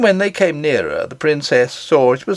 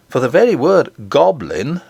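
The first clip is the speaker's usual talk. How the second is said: plain reading voice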